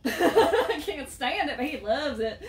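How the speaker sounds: slow, laughing southern drawl; southern accent, amused and stretched out. Shown as a demonstration. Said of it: "in my southern accent".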